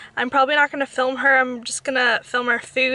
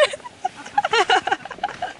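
high laugh; 9 semitones higher than usual. Real, not acted. Laugh after getting lost.

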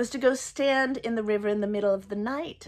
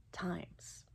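whispered; 2 semitones lower, 15 dB quieter than usual. Real, not acted. Whispered end of a sentence.

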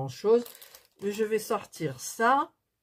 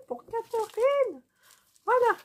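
much higher, louder burst of delight; petit cri de victoire; 3 dB louder than usual. Real, not acted. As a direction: crow it out, thrilled to have found the name. Trouvé toutes les lettres du prénom. (ravie d'avoir trouvé les lettres, ton triomphant)

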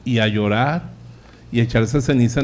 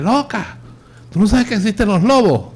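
much higher, shrieking mock scold of a runaway sheep; scolding shepherd; 9 semitones higher than usual. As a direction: play a furious nag threatening a spanking. Imagined angry shepherd scolding sheep. (pastor regañón chillando a la oveja perdida)

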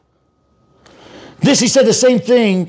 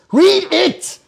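escalating demand; 8 semitones higher than usual. Real, not acted. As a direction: Read it pitched much higher, urgent.

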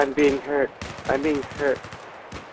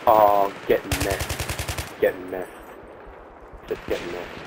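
smug taunt; 6 semitones lower, 5 dB quieter than usual. Real, not acted. Taunting after a kill.

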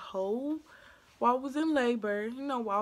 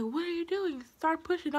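higher-pitched, insistent questioning; pitched-up impatient coaching; retold urging; 7 semitones higher than usual. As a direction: imitate someone urging a mother on. Retold staff urging her to push.

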